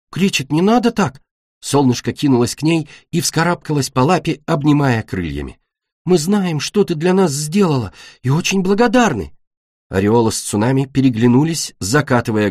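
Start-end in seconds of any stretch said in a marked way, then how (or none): 0.1-1.2 high-pitched plea; pleading protest. Acted. Солнышко pleads with Кречет. 6.0-9.3 high, eager reassurance; earnest placating. Acted. Солнышко earnestly placates Кречет.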